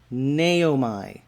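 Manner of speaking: Naomi as nay-OH-my, stress on the first syllable; self-pronouncing Naomi. Shown as a demonstration. Teacher reading the chart's version of Naomi aloud, nay-oh-my. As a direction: read Naomi off the chart as nay-oh-my, ending in a long I. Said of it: "The accent there is on the first syllable".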